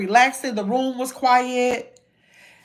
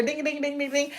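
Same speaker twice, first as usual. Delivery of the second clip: imitated bell; 10 dB quieter than usual. Shown as a demonstration. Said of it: "I don't have my bell here".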